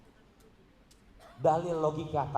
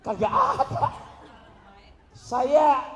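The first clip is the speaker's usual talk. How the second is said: high, loud laughing outburst; 11 semitones higher, 8 dB louder, much slower than usual. Real, not acted. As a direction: burst out laughing at it. Laughing at the odd prayer.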